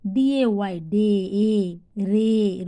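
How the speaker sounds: ডে, রে with a long, held "ay". Shown as a demonstration. Teacher showing the sound of A, drawn-out "ay" in ডে, রে. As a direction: spell ডিএওয়াই, then stretch the "ay" in ডে, রে. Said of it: "এর উচ্চারণ এ".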